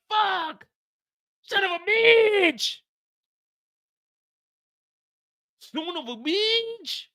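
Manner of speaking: high, slow drawn-out cursing; dismayed curse. Retold or imitated. Miguel hearing the five million bail, high drawn-out curse. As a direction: let the shock drag out.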